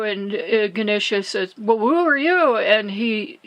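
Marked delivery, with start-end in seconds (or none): 1.6-2.6 higher, louder challenge thrown back; acted-out defiant comeback; defiant retort. Ganesha answers the stranger at the door, retold defiance.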